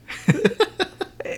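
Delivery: shrill laugh; high burst of laughter. Real, not acted. Laugh after parent impression.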